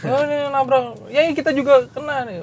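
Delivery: high, softened imitation of a friend; Lea. Friend explains the collision to the man, high soft imitated voice. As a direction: lift the pitch and soften, explaining politely. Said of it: "si Lea langsung".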